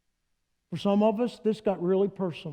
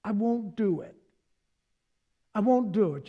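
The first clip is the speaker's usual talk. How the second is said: placating Jesus; 3 dB quieter than usual. Retold or imitated. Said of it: "he'd say".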